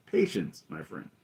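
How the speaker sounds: a hint of the Emperor, trailing to a hush; Emperor's soothing line. Emperor calming hasty Vader, lightly voiced and hushed. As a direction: ease it out, fading to a near whisper.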